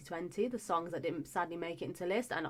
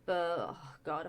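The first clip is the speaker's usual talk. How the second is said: usual speaking voice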